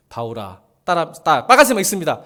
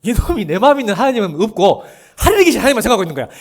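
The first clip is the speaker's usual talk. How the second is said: fast, laughing through the words; 4 semitones higher, 5 dB louder, faster than usual. Real, not acted. Preacher laughing at a believer who forgot God. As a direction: chuckle your way through it.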